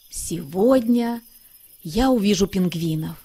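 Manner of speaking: ordinary delivery, nothing marked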